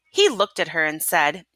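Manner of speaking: plain reading voice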